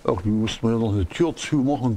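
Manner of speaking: ordinary talk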